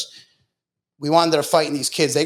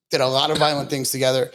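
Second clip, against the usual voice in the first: ordinary talk, nothing marked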